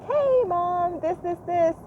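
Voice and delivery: put-on high, eager little-kid voice; a son interrupting mom's treadmill time, eager and high; imitating her son. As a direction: chirp it like an excited small boy. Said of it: "my son came in and was like".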